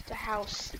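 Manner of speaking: usual speaking voice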